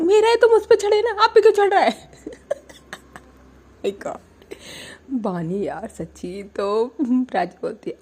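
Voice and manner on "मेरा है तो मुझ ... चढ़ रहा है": high, loud jealous whine; loud high laughing complaint; whiny shout. Retold or imitated. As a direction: wail it, laughing.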